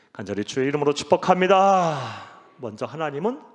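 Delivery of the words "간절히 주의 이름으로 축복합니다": loud preached benediction; louder pulpit pronouncement; solemn blessing. Real, not acted. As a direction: bless them with full force.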